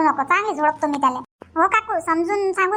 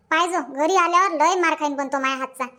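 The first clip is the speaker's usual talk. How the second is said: ordinary talk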